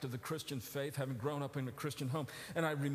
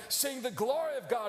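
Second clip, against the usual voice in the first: usual speaking voice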